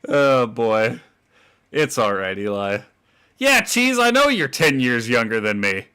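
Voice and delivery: ordinary delivery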